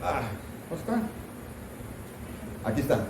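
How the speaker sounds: normal talking voice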